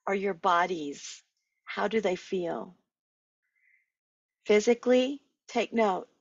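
measured meditation guide with long pauses; guided meditation. Acted. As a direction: lead listeners inward, one unhurried phrase at a time.

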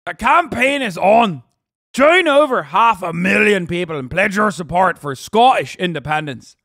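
put-on loud, rousing Irish accent. Streamer rallies supporters for Scottish independence, mock Irish accent. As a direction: proclaim it like a campaign rally in an Irish accent. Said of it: "I'm doing an Irish one".

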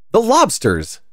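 high, loud Gryphon shout; a leaping creature shouting high and loud; excited shout. A narrator's reading. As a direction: burst out with it, pitched high. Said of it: "shouted the Gryphon".

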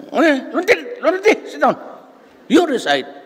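high put-on teacher's voice; mock professor voice. Professor calling on student to recite, put-on high voice. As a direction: call on him like the old professor. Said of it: "sabi niya".

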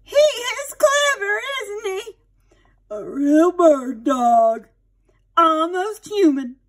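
put-on high, slow, gushing old lady; admiring aunt. Aunt Sarah praising Benji to everyone, high slow gushing. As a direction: gush over the clever dog.

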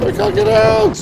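high, loud frustrated yell; frustrated shout. Real, not acted. Stuck in the rails.

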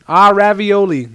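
loud, high, drawn-out exclamation; high, exuberant game-hero cry; playful Mario impression. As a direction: sing it out big and slow. Reading Mario's exclamations aloud.

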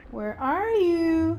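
high, drawn-out searching call. Real, not acted. Player hunting for the hidden big guy, high searching call. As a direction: call out high, stretching the last word.

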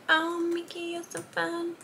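a hint of a gushing TikTok voice; TikTok quote. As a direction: lightly mimic the viral line, up high. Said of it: "what I've got stuck in my head".

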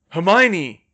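loud, urgent call; full-voiced shout into the distance. A narrator's reading. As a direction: yell the name so it carries. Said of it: "Harry shouted at the top of his lungs".

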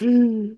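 high, held mock wail; mock crying noise. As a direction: let out one high, put-on crying squeal. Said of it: "I could have fucking cried".

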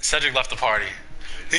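normal talking voice

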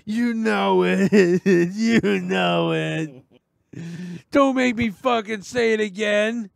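high, very slow drawn-out taunt; menacing prank caller. Retold or imitated. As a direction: stretch every word to torment him. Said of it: "I just kept saying".

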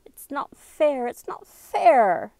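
high, loud, drawn-out protest; indignant complaint. A narrator's reading. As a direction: wail it slowly, stretching each word in protest. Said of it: "Sarah was muttering to herself".

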